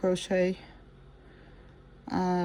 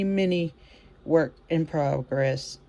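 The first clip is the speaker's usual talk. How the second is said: normal talking voice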